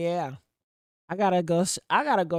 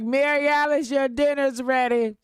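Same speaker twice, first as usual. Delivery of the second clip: higher, louder call to dinner; yelling across the room; 5 semitones higher, 4 dB louder than usual. Pretend. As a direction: call her from the next room. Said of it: "He gonna be like".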